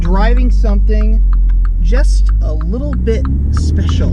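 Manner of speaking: big booming welcome to viewers; loud presenter intro. Acted. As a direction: announce it loud like a show host. Loud car video intro.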